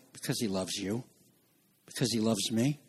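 low, very soft, intimate answer; hushed tenderness. Real, not acted. Preacher tells congregation why the Father crushed him, hushed. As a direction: drop to a gentle near-murmur.

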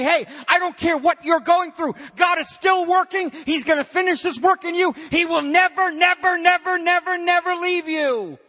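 high-pitched, building declaration of God's promise; emphatic preaching. Acted. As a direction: hammer each "never" at a sustained high pitch. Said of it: "doubling up and tripling up these negatives to say".

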